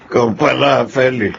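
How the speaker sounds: put-on drunken greeting. Drunk Diego greets Feli.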